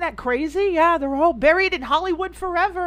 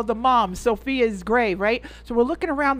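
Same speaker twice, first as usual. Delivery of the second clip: normal talking voice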